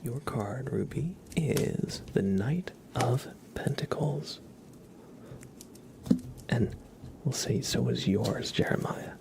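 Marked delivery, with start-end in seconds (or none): none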